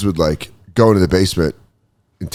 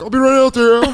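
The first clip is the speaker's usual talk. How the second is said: very high, caught-in-the-act reply; guilty call-out; 14 semitones higher than usual. Retold or imitated. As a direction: call innocently to the next room, pitched way up. Caught snacking, calls to partner.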